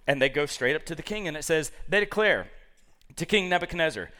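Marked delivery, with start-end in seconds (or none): none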